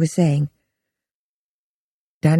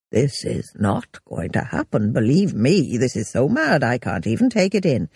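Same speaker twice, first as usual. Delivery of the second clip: low and disbelieving, holding back fury; angry ex-husband; 5 semitones lower than usual. A narrator's reading. Danny refusing Rhea's plan, low and incredulous.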